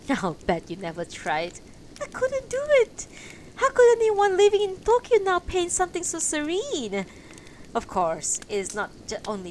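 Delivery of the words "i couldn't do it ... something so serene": high, loud exclaiming painter; excited exclamation. Acted. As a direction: throw up your hands in wonder.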